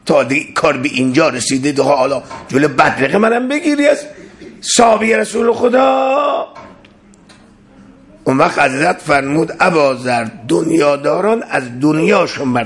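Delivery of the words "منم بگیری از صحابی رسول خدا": indignant outcry. Acted. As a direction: cry out in disbelief.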